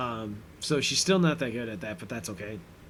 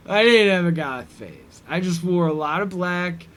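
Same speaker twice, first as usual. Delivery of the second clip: mock indignant denial; 4 semitones higher, 6 dB louder than usual. Imitating brother denying a goth phase.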